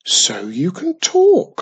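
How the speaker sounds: delighted she got an answer; surprised. A narrator's reading. Witch surprised that the tree can talk.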